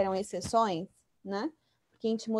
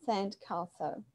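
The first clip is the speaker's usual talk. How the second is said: British "sand castle", softer, long "ah" in castle; British pronunciation; 3 semitones lower, 7 dB quieter, much slower than usual. Shown as a demonstration. Teacher repeating the example for students, British pronunciation. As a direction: say CASTLE the British way, "kah-sul". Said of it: "Em inglês britânico, né?".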